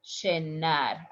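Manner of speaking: llenar as "shenar"; stronger sh pronunciation. Shown as a demonstration. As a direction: start llenar on a hushing sh. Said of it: "A little bit stronger".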